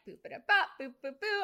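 high, bouncy boops dropping in volume; nonsense bebop syllables. Retold or imitated. Performer recalling how she breezed through the audition, playful bebop noises. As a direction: toss off playful scat-like boops.

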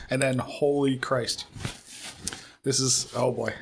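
very slow, strained from the burn; overwhelmed by chili heat; mouth burning from spicy food. Real, not acted. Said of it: "I'm starting to cry".

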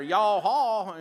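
mock southern greeting. Shown as a demonstration. Pastor jokes about mispronouncing Hebrew, mock southern greeting. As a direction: drawl "Hi, y'all" like a southern hello. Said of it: "getting a southern twang going here".

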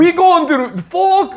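high, slow hammed-up Austrian accent; mock Austrian accent. Host spoofs Hans and Franz for fans, mock Austrian accent. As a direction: drag it out in a fake Austrian voice. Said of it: "can you do an Austrian accent?".

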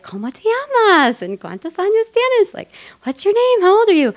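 put-on high, sweet voice for a little girl; talking to a child. She talks to a little girl in the truck, high and sweet. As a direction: coo at a five-year-old. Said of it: "use my shitty Spanish to talk to her".

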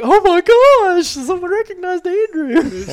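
high, slow, gushing mock squeal; mock excited. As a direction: tease him like giddy ladies. Mom and mother-in-law teasing.

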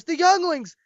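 high, stricken cry; horrified Padawan. Retold or imitated. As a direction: gasp it out in horror. Padawan voiced seeing children shot.

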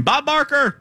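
put-on high squealed name like an excited kid; child's scream imitation. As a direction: squeal the name like a little boy. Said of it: "But he would just scream".

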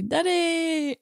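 drawn-out singsong call; calling Dad. Retold or imitated. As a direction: imitate a grown child calling out fondly to Dad. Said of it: "You call him and say".

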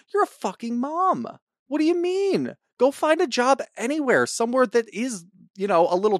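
incredulous angry rant; exasperated rant. Real, not acted. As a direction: rail at the mother in disbelief. Ranting at the mother.

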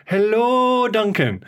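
a hint of Jo's Irish greeting; imitating Irish accent. Duncan imitating Jo's cheerful Irish greeting. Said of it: "she's like".

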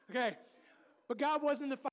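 usual speaking voice

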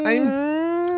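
high thin buzzing whine; mosquito whine. Acted. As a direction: whine like a mosquito in your ear. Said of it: "That was [name]' mosquito impression".

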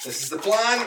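ordinary talk, nothing marked

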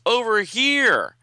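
high, loud, slow call. Acted. Hidden heroine calls out to get his attention. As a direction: call him over from below. Violet calling out to him.

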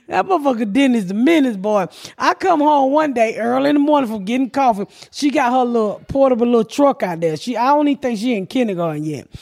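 normal talking voice

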